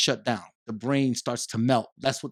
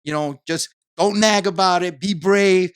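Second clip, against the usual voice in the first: higher, louder mock of a mother putting on a brave face; imitating Caribbean mother; 6 semitones higher, 5 dB louder than usual. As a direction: play the strong mom who insists everything's fine, higher and louder. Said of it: "Like, you know, they tell you".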